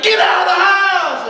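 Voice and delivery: drawn-out, high panicked yell; freaking out. Acted. Sibling yelling at brother in a burning house, freaking out. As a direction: scream it in hammed-up alarm. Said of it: "I'm freaking out".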